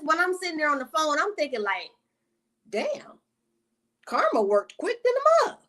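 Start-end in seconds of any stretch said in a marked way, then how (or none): 2.7-5.6 high-pitched mock astonishment; amazed. Thinking to self on the phone about karma, high amazed. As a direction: act out a stunned thought way up high.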